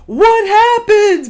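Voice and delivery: high, loud, drawn-out astonished cry; alarmed shock. Retold or imitated. Husband to wife who suddenly burst into tears, loud alarm. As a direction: blurt it out as a stretched, high exclamation.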